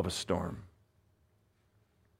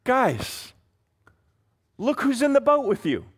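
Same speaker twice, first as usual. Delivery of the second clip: higher, gently chiding friend; Jesus nudging his frightened friends, pitched up; voicing Jesus; 8 semitones higher than usual. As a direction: coax the disciples to see him. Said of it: "Jesus is saying".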